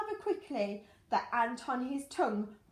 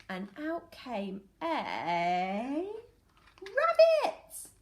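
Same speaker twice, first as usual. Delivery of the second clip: suspense reveal; 2 semitones higher, 5 dB louder, much slower than usual. A narrator's reading. Teacher reading to children, building suspense before the rabbit appears. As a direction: drag it out, then shout the answer.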